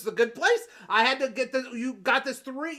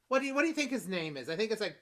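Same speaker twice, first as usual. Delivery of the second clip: normal talking voice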